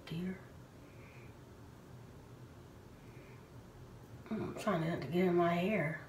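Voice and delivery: sheet mask on face; through a face mask. Real, not acted. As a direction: hands pressing mask to face. Seen 0: wet sheet mask covers face; mouth hole leaves lips open.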